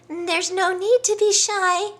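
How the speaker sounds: high, loud giraffe voice; gawky giraffe. Acted. Giraffe coaxing the shy lion out, high. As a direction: coax him out cheerfully.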